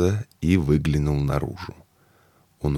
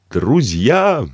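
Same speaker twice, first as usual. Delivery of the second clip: high, loud booming cry; booming call; 9 semitones higher, 8 dB louder than usual. Acted. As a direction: boom it to the crowd. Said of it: "вскричал он зычным голосом".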